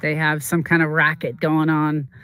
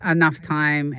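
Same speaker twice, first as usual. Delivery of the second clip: ordinary talk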